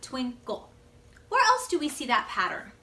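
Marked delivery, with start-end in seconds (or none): 0.0-0.7 "twinkle" as two beats, twin and kle; broken into syllables. Shown as a demonstration. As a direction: pause inside "twinkle" before the kle. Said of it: "the word breaks like that".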